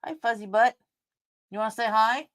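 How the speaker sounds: high cooing pet voice; lifted, sweet voice for a pet. Real, not acted. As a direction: coo at the cat. Talking to her cat.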